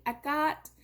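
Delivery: "Ekat" with no H, cut to two syllables; H left silent. Shown as a demonstration. Lecturer showing a third Greek dialect form, H left silent. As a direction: say "Ekat" without any final vowel. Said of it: "don't pronounce the H at all".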